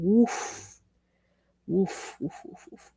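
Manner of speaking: breathy unvoiced woofs; whispered woof. Real, not acted. Streamer whispering woof after a sharp reply. Reacting to the tweet.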